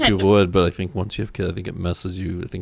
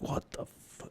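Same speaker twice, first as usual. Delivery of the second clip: startled whisper; 17 dB quieter than usual. Acted. Startled reaction acted out.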